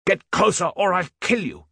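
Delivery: high desperate threat yelled; furious shout. Acted. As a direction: scream the threat over gunfire. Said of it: "Hals shouted".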